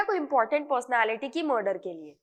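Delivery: ordinary talk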